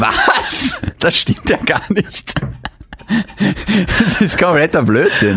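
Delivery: high laughing what, then slow denial; shocked disbelief. Real, not acted. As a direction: caught off guard, laugh and deny.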